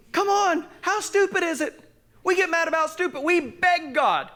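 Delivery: high-pitched, insistent pulpit delivery; fervent preaching. Real, not acted. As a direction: exhort the church with rising fervour. Preaching at full force.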